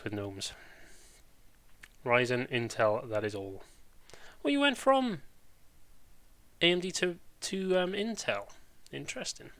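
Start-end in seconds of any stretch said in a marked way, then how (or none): 4.4-9.5 mock disbelief. Real, not acted. Teasing a team switcher.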